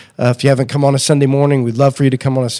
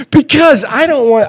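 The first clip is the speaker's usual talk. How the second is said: high, laughing through it; can't stop laughing; 12 semitones higher than usual. Real, not acted. Laughing at guest's reaction.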